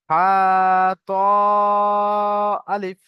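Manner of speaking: হা and ত drawn out long, আলিফ left short; one-alif stretch. Shown as a demonstration. Teacher models letters for students to repeat, stretched then short. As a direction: hold হা, then ত, and say আলিফ without any stretch. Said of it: "হা এক আলিফ টান".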